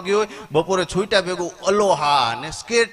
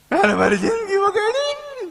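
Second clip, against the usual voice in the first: much higher, slow hammed-up sobbing wail; crying man; 3 dB louder, slower than usual. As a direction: wail it like a man weeping over his ruined life. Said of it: "રોતો જાય". (જિંદગી બગડી એમ રડતા માણસનો ખૂબ ઊંચો, ધીમો, નાટકીય વિલાપ)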